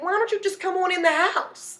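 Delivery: high, laughing mock grandma scold; lightly voiced sister nagging from the stoop, laughing.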